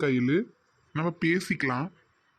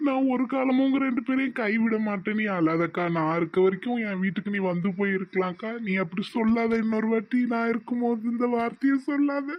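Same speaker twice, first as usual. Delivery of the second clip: emotional pleading, building; 6 semitones higher than usual. Acted. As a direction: pitch climbs higher to an anguished peak. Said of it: "பாச மழையை எப்படி பொழிறவன்".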